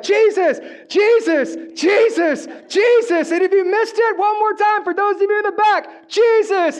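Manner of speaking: high, loud pulpit shouts; all-out preaching shout. Shown as a demonstration. Pastor shows the church his own preaching style, shouting full force. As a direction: let it go, crying out the name to the back row. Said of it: "I just let it go".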